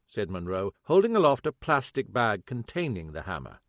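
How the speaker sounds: ordinary talk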